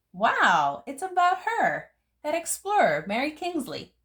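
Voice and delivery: high, bright burst of wonder; excited. A narrator's reading. Child thrilled by a signed book about Mary Kingsley. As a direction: gasp with delight at the book.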